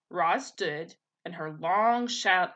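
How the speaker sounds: plain reading voice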